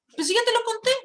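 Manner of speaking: high-pitched exasperated protest. Retold or imitated. Daughter to someone who forgot what she told, exasperated. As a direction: imitate a daughter's indignant complaint. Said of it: "Pero me da rabia".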